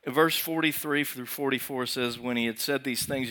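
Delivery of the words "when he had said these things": usual speaking voice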